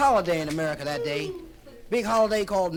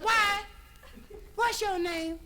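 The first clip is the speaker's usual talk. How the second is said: put-on much higher, sassy girl's voice; sassy Indian girl; 10 semitones higher than usual. Indian girl on the beach challenging Chris, high and sassy. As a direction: give him attitude in a high voice. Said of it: "She said".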